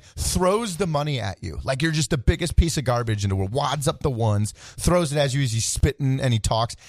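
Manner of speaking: ordinary delivery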